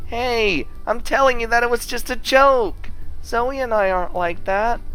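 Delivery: high-pitched insistence; high, flustered denial; anxious protest. Acted. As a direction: protest urgently, pleading to be believed. Insisting it was only a joke.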